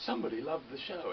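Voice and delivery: delighted high exclaim; thrilled at being loved; excited. Retold or imitated. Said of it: "you're like".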